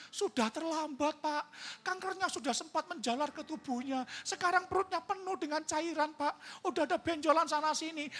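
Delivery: high-pitched worried mother reporting; imitated anguished mother's voice; distressed mother phoning. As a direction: pour out the bad news, pleading. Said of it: "mamanya telepon saya".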